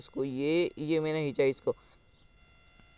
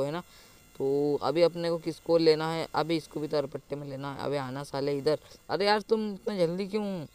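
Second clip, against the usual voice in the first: ordinary talk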